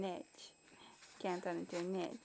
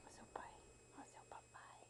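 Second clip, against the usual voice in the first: hushed whisper to the dog; 22 dB quieter, much faster than usual. Real, not acted. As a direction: breathe it softly.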